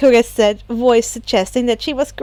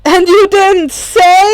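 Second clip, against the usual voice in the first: betrayed; 8 semitones higher, 9 dB louder, slower than usual. Acted. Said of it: "looking positively betrayed".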